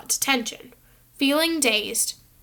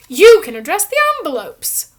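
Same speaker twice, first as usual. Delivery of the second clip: high, loud and pleased, like granting a treat; grand showman voice; 6 semitones higher, 11 dB louder than usual. A narrator's reading. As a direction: hand him the job as if it were a gift. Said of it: "as though that was a huge treat".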